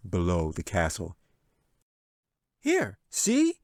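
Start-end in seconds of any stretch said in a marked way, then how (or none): 2.6-3.6 put-on higher, fast, cocky boy; confident kid with a plan; high quick boyish voice; eager boy. A narrator's reading. Jack voice held.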